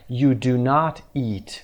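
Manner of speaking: slow I do not eat, not stressed. Shown as a demonstration. Teacher spelling out the negative, not stressed. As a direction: lean on not, slowly. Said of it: "not رو باید بعدش بیاریم".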